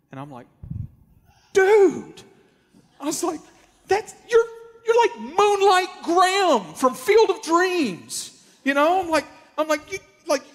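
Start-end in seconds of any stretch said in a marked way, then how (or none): 1.5-10.4 much higher and louder, slow and thrilled; overwhelmed with excitement; amazed. Retold or imitated. Telling the pitcher he's like Moonlight Graham, much higher and thrilled.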